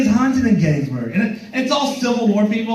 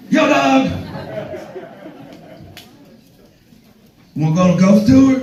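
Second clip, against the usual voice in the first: yelled street greeting; 5 semitones higher than usual. Retold or imitated. Tour guy hollering at the comedian. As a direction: call out like a pushy tour hustler. Dude pitching a ghost tour.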